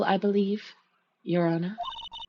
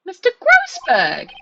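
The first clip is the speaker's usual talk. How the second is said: loud, high, slow outburst; shocked shout; 10 semitones higher, 11 dB louder, slower than usual. Acted. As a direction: call his name in alarm. Judge shouts at attorney.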